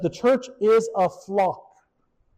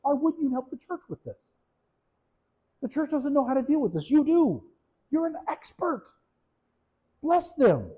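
exasperated; 8 semitones higher than usual. Retold or imitated. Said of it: "that's all I could think is".

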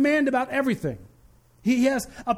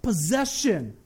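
slow, tender voice of God, rising with feeling; gentle divine assurance, drawn out; God speaking tenderly; 3 semitones higher, much slower than usual. Acted. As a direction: reassure them, slowly and warmly. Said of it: "God still looks at you and says".

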